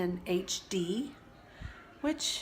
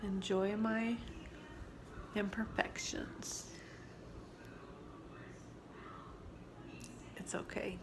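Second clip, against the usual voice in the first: soft, slow, fading to a whisper; rueful hush; 10 dB quieter than usual. Real, not acted. Mother telling viewers to enjoy her wrinkles, soft and rueful. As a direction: accept it quietly, half smiling. Self-deprecating about wrinkles.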